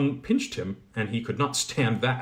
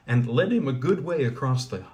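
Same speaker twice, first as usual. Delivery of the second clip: ordinary delivery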